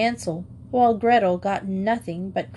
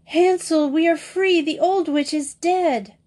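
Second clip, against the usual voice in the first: joyful cry; 8 semitones higher than usual. A narrator's reading. Gretel runs to Hansel with the news, overjoyed cry. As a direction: shout the good news.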